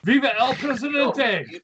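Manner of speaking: high, slow proud exclamation; rallying cry. Acted. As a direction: proclaim it like a loyalist at a rally.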